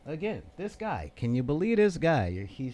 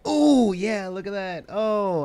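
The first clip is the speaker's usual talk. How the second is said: mock excited gloat; 6 semitones higher, 9 dB louder, slower than usual. Voicing Luke Cage getting up.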